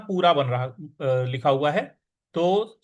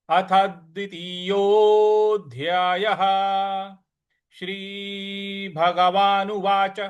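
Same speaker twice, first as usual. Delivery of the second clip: slow, formal chant of the heading; scripture recitation; 3 semitones higher, much slower than usual. Acted. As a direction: intone the chapter heading slowly.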